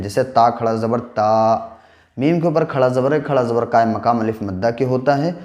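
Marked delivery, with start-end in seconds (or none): 0.2-1.7 slow spelling that ends in a long ता; stretched vowel demo. Shown as a demonstration. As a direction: draw out the final ता for one alif. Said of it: "एक अलिफ के बराबर खींच के पढ़ते हैं". (ता को एक अलिफ खींचकर पढ़ें)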